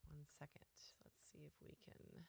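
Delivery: hushed whisper while fixing the setup; a whispered aside while sorting out the tech; real whisper to herself. Real, not acted. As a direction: whisper it under your breath.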